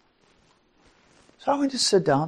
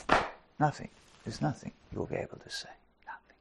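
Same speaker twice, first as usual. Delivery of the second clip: fading to a whisper; 4 semitones lower, 12 dB quieter than usual. Acted. Fading quiet on nothing.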